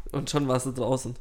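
usual speaking voice

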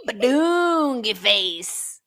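slow drawn-out. Acted. Drawn-out silly word.

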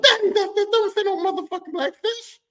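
put-on high, loud little-kid protest; kid self protesting. Kid telling daddy the book doesn't say that, high and loud. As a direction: squeal it like a kid correcting his dad.

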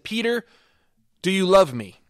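Jesus asking. Acted. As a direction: press the question gently but earnestly. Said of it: "Second time he said".

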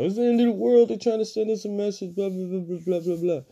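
high, hammed-up doomsayer panic; mocking paranoid people. Mocking online doomsayers in a high, panicky voice. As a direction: mimic a frantic doom prophet. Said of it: "some people are going to be paranoid".

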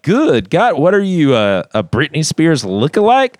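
loud, high mocking jeer at the worship leader; asshole tone. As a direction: taunt her for the crowd. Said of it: "he's got an asshole tone".

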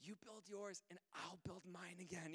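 imitated toddler's soft reassurance; hushed little-kid voice; small child. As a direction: explain it softly like a three-year-old. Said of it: "He says".

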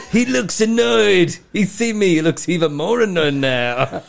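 high, loud, stretched mock commentary; hyped-up commentator. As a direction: report each glance as high drama. Acted commentary on a bus.

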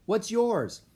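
high-pitched return question; yelling back. A narrator's reading. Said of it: "Annie yelled back".

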